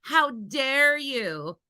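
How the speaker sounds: parodying scandalized critics; mocking outrage. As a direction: slowly ham up the pearl-clutching. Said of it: "everybody being like".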